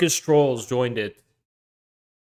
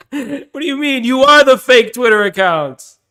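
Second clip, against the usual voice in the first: high, loud mock outrage; mock indignant retort; 9 semitones higher, 5 dB louder than usual. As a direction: throw it back incredulously, voice pitched up. Mock retort to the quoted meeting.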